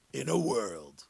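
trailer announcer voice. Pretend. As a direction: drop into the deep trailer voice on "in a world". Movie trailer parody.

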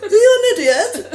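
high, slow laughing posh British English insult; mock British insult. Scene: showing that British swearing sounds nice, mock British accent. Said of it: "Puedo estar puteando ahí".

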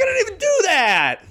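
high indignant yell; childish shout. Retold or imitated. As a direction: yell like a wronged kid. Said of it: "It's like".

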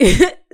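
high, loud burst of laughter; a sudden loud laugh breaks out. Real, not acted. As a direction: crack up mid-story. Laughing while telling the story.

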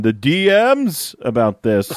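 funny way of saying it. Real, not acted. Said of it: "Did you have to say it like that?".

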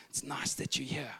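soft bedtime murmur; hushed child. Retold or imitated. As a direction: confide quietly in dad. Son confides to dad in bed.

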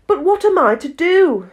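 put-on high girlish voice. A narrator's reading. Alice exasperated.

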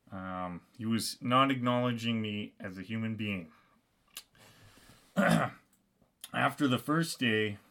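normal talking voice

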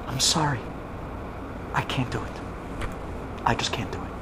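low and hushed, barely getting it out; quiet, broken refusal. Acted. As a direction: apologize softly, voice sinking away. Groom backs out at the altar.

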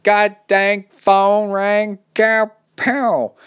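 loud, high, slow Texas twang; hammed-up Texas accent, words stretched out. Host imagining the listener as a Texan, exaggerated Texas accent. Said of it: "said it with a Texas accent".

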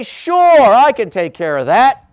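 mock sunny parent; eager yes. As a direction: promise it happily. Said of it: "And you say".

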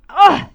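high, loud startled yelp; sudden comic cry of panic; mock alarmed gasp. Woman reaches for the book, startled mock yelp. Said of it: "I'm like".

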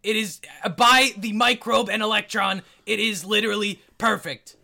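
loud, high, over-enunciating chef; shouting chef. Acted. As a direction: proclaim it like a mad chef. Chef voice, same booming character.

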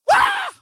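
put-on high, piercing girlish shriek; schoolgirl scream. Preacher recalling hearing the dog, high girlish scream. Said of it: "I screamed like a sixth grade schoolgirl".

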